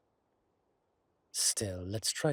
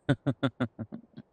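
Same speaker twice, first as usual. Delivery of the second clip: amused laugh; 4 semitones higher, 6 dB louder than usual. Acted. Vampire laughs at welcoming party.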